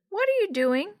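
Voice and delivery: put-on high-pitched little kid question; little kid character voice; young child's voice.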